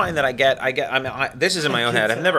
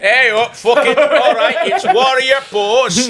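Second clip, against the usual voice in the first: high, louder, slow Yorkshire yoga teacher; gruff Yorkshire instructor barking poses; Yorkshire accent; 8 semitones higher, 6 dB louder, slower than usual. Pretend. Playing a Yorkshire yoga teacher to the class, thick Yorkshire accent. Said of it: "The Yorkshire yoga".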